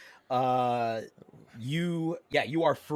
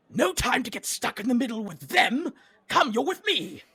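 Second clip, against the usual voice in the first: high, loud, hurried character shout; urgent command; 11 semitones higher, 5 dB louder than usual. Acted. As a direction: call out urgently, hauling someone along. Creature grabs player, urging them along.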